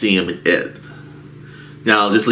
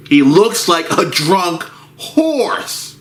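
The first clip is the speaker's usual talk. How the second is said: pitched up and slow; higher drawn-out repeat; 9 semitones higher, slower than usual. Shown as a demonstration. Narrator repeating for effect, higher and slow. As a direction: say it again higher and slower.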